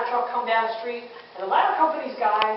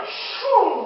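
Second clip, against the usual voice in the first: water rush sound; 8 semitones higher, 6 dB louder than usual. Retold or imitated.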